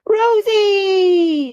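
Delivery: put-on high, loud, childlike cry of a name; small boy calling his sister; little boy's call. A narrator's reading. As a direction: call her like an excited toddler. Little boy voiced by narrator.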